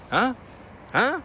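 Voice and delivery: high, puzzled double huh; baffled surprise. Retold or imitated. Passenger told to bring his bag, high baffled surprise. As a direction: squeak out a retold bewildered reaction. Baffled at being moved mid-flight.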